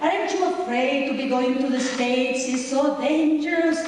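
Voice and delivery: normal talking voice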